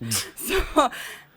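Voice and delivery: a burst of high laughter; sudden bright laugh; loud high laugh. Real, not acted. Laughs after retelling shock.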